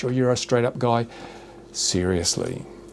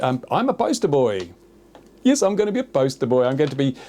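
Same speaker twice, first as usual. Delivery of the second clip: ordinary delivery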